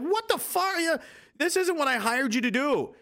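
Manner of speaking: high-pitched indignant protest; mock outraged boss. Customer confronting the sandwich guy he hired, mock outrage.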